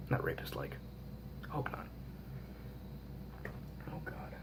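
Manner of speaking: hushed aside whisper. Real, not acted. Vlogger whispers a correction to viewers, breathless whisper. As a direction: drop to a whisper for the joke. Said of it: "whispered aside".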